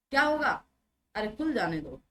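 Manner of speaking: ordinary talk, nothing marked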